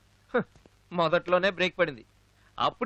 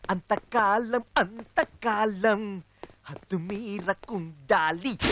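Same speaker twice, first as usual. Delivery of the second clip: drawn-out recital; 4 dB quieter, much slower than usual. Acted. Slow dramatic recited line.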